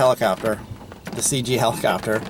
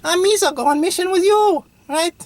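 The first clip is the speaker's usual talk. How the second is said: very high, loud cartoon alien squeal; hammed-up falsetto sidekick impression; Jar Jar Binks; 4 dB louder than usual. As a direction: put on a silly high-pitched goofy creature voice. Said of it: "just Jar Jar Binks walks in".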